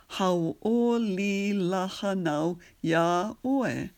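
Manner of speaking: spoken slowly, syllable by syllable; slow practice phrase. Shown as a demonstration. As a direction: model the phrase slowly for learners. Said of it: "So the whole phrase".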